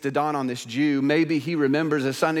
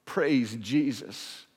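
usual speaking voice

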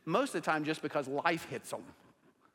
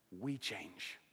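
soft, lower, hushed; quiet; 5 semitones lower, 8 dB quieter than usual. Shown as a demonstration. Pastor confessing to the congregation, quiet and low. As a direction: drop it to a near hush. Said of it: "contrasting God's constancy with us".

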